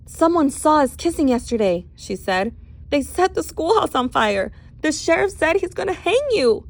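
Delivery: high, urgent telling; frightened rush. Acted. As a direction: spill the danger out fast. Panicked explanation.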